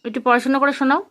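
put-on bright, loud sing-song quizzing of a toddler; loud child-directed teaching. Mother quizzing her toddler on letters, in a loud sing-song. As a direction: coax the little one through letters and numbers, loud and eager.

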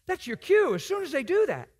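loud, high preacher's call; urgent exclamation. Real, not acted. Preacher telling the congregation when to step in, urgent shout.

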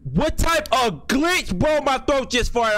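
high, incredulous exclamation; startled disbelief. Real, not acted. Streamer startled by a glitch, high-pitched disbelief. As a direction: exclaim it high, caught off guard.

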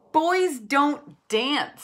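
slow, high, mocking kid voice; scornful boy. A narrator's reading. Ben teasing his brother Nate, slow and mocking. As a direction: drag it out like a teasing big brother.

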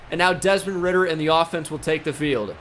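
ordinary talk, nothing marked

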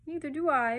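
high, loud mock sob; weeping. A narrator's reading. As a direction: wail it like a little child. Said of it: "wept Barbara".

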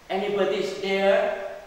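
loud call from the doorway; shouted question. Acted. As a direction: call out to whoever is outside. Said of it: "shouted the king".

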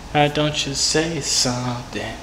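slow, stretched "say something"; drawn-out coaxing. Real, not acted. Coaxing a silent caller to talk, "say something" very slow. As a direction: stretch out "say something" slowly and evenly. Coaxing after repeated tries.

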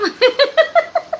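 high, loud burst of giggles; amused laugh. Real, not acted.

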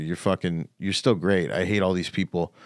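usual speaking voice

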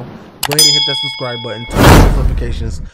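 shouting the subscribe call; loud hype plea. Real, not acted. Begging viewers to subscribe with loud shouts. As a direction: belt it like a hype man.

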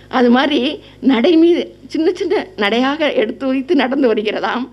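ordinary talk

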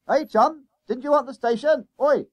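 high, loud hail; yelling. A narrator's reading. Conductor hailing a schoolboy down the bus, yelling. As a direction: shout to get his attention. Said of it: "he yelled down the bus".

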